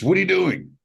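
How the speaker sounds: imitating the coach catching him reading a bad review; mock coach yell. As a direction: bark it like a startled coach.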